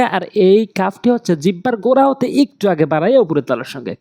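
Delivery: usual speaking voice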